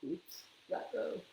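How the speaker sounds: ordinary delivery, nothing marked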